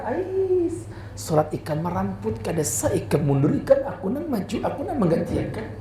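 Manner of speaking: put-on Banjar accent. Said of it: "Kata orang Banjar".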